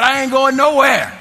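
loud, high, acted-out refusal; indignant outburst. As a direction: shout it back, comically immovable. Retold argument, final refusal.

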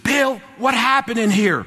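high, urgent acted-out outcry; alarmed shout. Supervisor bursting in on the clerk, alarmed shout. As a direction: burst in with a startled demand.

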